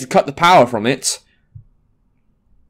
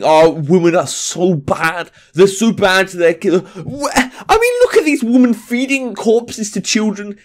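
put-on crying outrage at the women; mock outrage; 3 semitones higher than usual. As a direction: whine it like a fake sob.

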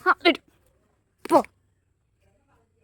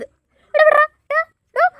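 much higher, louder yelp of a shout; sharp shout; 11 semitones higher, 4 dB louder, much slower than usual. Real, not acted. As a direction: shout at the dog to stop. Dog grabs the stick.

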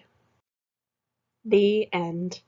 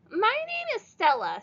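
put-on high, bright girl's introduction; little girl voice; 13 semitones higher than usual. A narrator's reading. Stella introduces herself to Leroy, high child voice. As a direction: pitch it up like a small girl. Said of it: "said the girl".

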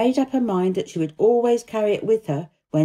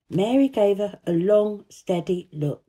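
plain reading voice

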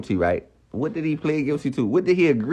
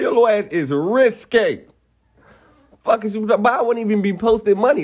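ordinary talk

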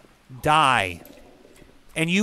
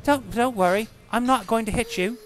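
higher-pitched, hammed-up fake British; bad British accent; 5 semitones higher than usual. Shown as a demonstration. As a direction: put on a lousy British accent. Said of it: "My British accent's just fucking shit".

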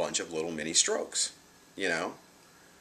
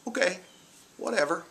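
high, breezy brush-off; mild mock dismissal to the doctor; dismissive; 12 semitones higher than usual. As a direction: wave it away lightly. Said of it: "I just smiled and I was like".

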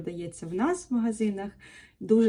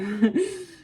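higher, louder laugh at oneself; amused laugh; 10 semitones higher, 5 dB louder than usual. Real, not acted. Laughing at her own mispronunciation, amused burst.

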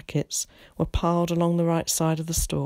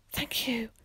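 soft, high little whisper; 6 semitones higher, 8 dB quieter than usual. A narrator's reading. Dumbfounded Willie thanks Missus Miller for the apple, whispering. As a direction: whisper it timidly. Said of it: "he whispered".

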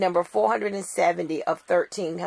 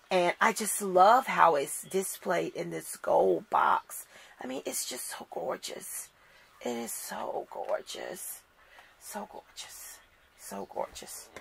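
adoring whisper; 6 dB quieter than usual. Real, not acted. Whispering admiration of gold box.

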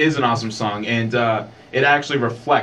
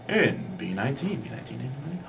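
bingo caller; 2 semitones higher, 10 dB quieter than usual. Retold or imitated. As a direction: call the numbers like a bingo hall. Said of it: "the weird voice".

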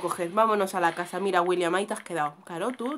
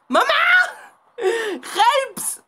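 high, loud yelp; frightened cry for help; 12 semitones higher, 10 dB louder, much slower than usual. Real, not acted. Player calls for mum and help, frightened shout.

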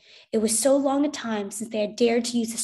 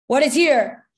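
louder, higher soldier's question; sharp, commanding query; loud demand; 4 semitones higher, 10 dB louder, slower than usual. A narrator's reading. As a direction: bark the question. Officer demands outside the door.

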